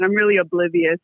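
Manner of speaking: normal talking voice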